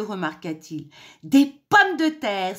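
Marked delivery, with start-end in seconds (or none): 1.2-2.6 loud, high, drawn-out realisation; exclamation. A narrator's reading. Mother hen remembering the potatoes, loud exclamation. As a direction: exclaim slowly as it dawns on you. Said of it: "s'exclama la mère poule".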